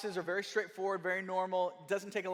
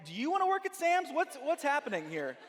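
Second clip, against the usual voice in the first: incredulous; 7 semitones higher than usual. Retold or imitated. Pushing back at dad's nagging.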